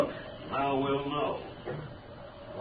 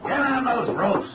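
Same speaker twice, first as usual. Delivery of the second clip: high, loud barked command; shouted order; 8 dB louder than usual. Acted. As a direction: drive the crew on. Commissioner orders the men.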